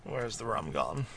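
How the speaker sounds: low, slow drawled pirate line; lazy drunken pirate; Jack Sparrow voice. Acted.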